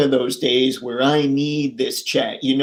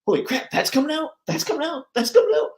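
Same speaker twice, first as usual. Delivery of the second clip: excited reaction; 12 semitones higher, much faster than usual. Retold or imitated. Streamer recalling the week's reveals, excited. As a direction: rattle it off high, like giddy discovery. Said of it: "I'm like".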